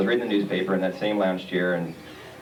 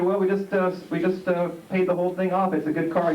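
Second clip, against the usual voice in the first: much higher, innocently explaining; high defensive reply; 10 semitones higher than usual. Retold or imitated. Husband cheerfully explains to wife, pitched high. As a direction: squeak out the excuse like it's obvious.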